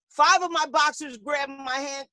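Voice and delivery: ordinary talk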